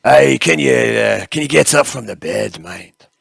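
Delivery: loud Scottish interrogator; Scottish accent. Acted. Interrogator asking patient to get up, Scottish accent. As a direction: open loudly in a put-on Scottish accent. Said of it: "I can do Scottish".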